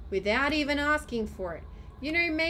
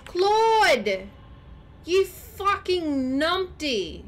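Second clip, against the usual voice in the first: loud, drawn-out angry yell at the screen; furious yell; 6 semitones higher, 7 dB louder, much slower than usual. Real, not acted. Yelling at Claude in the game, furious and slow. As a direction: blow up slowly at a useless teammate.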